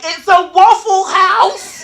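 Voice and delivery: high, very loud, drawn-out outburst; sister's exasperated yell, played big; exasperated shout. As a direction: yell it in comic disbelief. Sister exasperated at Perrier order.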